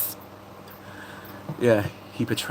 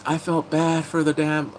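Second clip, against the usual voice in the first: ordinary talk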